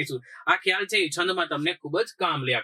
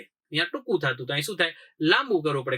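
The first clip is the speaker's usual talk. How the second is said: ordinary delivery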